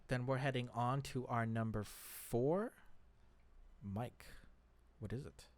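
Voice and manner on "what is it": ordinary delivery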